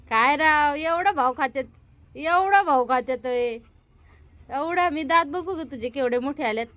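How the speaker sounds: ordinary talk, nothing marked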